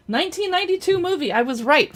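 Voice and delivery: triumphant. Real, not acted. Checks fact and was right.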